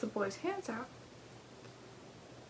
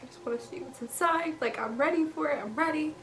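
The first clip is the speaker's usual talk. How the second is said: higher-pitched imitated eagerness, building; excited mom; 6 semitones higher, 3 dB louder than usual. Mom eager to see inside the crate, excited. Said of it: "you can tell she's excited".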